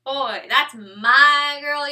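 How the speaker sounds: put-on loud, drawn-out hype for a girlfriend; proud bragging. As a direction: show off your girl, stretching the words out loud. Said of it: "He should be proud to have you".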